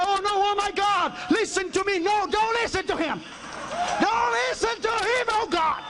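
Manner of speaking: frantic begging to be heard; desperate prayer. Retold or imitated. The businessman begs God to listen to him, desperate.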